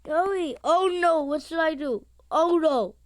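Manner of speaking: loud, high panicked cries. Real, not acted. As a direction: yelp it as things go wrong. Panicking as game threatens.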